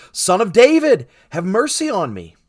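loud, high call, easing on the plea. A narrator's reading. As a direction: cry out insistently, refusing to be silenced. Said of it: "much more he cried out".

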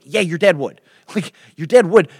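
acted insistence, punched out; insistent. Acted David insists to doubting friend.

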